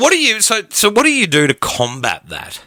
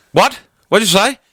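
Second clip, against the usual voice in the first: higher and louder, straining to hear; calling over the wind; 9 semitones higher, 4 dB louder than usual. Retold or imitated. Son straining to hear his dad over the wind, calling out. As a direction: call out the question over a gale.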